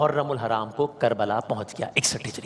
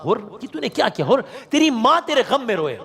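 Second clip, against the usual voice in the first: higher, fast rebuke; extreme displeasure; 8 semitones higher than usual. Retold or imitated. Preacher voicing the Imam rebuking Hurr, higher and rushed. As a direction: voice the Imam's sharp rebuke, high and quick. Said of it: "extreme ڈسپلیزر کا اظہار".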